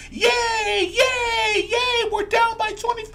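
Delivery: fake-celebrate a losing team; sarcastic fan yelling yay; mock cheer. Mock cheering fans while team loses.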